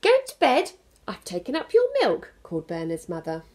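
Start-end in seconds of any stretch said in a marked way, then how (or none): 0.0-2.3 higher, louder call up the stairs; bossy bedtime call; calling mum. Acted. Mum calls Bernard up to bed from downstairs.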